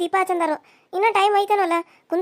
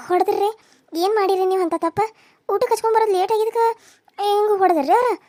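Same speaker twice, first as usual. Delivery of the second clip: put-on higher voice of a worried young bride; a young wife fretting over the in-laws, pitched up; young daughter-in-law; 4 semitones higher, 4 dB louder than usual. Bride comforts crying mother-in-law. (ಚಿಂತೆಯಲ್ಲಿರುವ ಸೊಸೆಯ ಎತ್ತರದ ಧ್ವನಿ)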